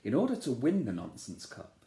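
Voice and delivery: ordinary delivery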